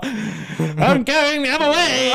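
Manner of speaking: high slow mock line; mock retreat. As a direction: announce the escape, drawn out. Retold quote, very slow.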